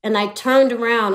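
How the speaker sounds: ordinary delivery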